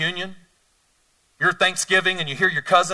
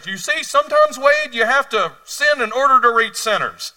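high, loud mock know-it-all; mocking misreader; 9 semitones higher, 9 dB louder than usual. Preacher mocks those who excuse sin, higher and louder. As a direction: send up the people who twist the verse. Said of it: "And they say".

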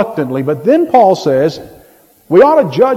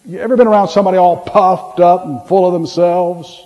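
normal talking voice

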